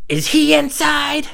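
high, slow strained question; jealous demand. Retold or imitated. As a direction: draw it out high, mock fury building. Acted jealous confrontation.

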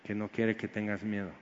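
very soft, low, gentle refrain; almost hushed comfort; tender assurance. Real, not acted. (casi susurrado, tierno)

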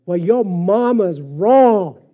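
slow, loud drill instructor yell; retold yell. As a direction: bark it like a drill sergeant. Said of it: "And he yelled it".

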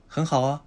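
很好啊 with a slight 'a' tacked on, rising; small 'a' after 很好. Shown as a demonstration. Teacher shows an easier rise, 很好啊 with small final 'a'. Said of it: "小さい".

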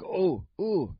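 high startled ooh; mock a startled gasp of realisation; high-pitched surprised oh. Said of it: "he's like".

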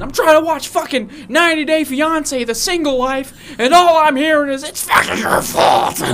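high, loud whiny complaint; annoyed neighbor. Retold or imitated. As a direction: complain loudly, exasperated.

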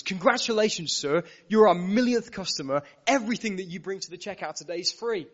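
loud, beaming shop-manager proclamation; jubilant announcement. Retold or imitated. Store manager announcing a millionth-customer prize, loud and jubilant. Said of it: "he says".

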